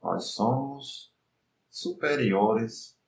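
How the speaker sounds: slow, soft meditation voice; guided meditation. Acted. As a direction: lead the mind calmly, unhurried.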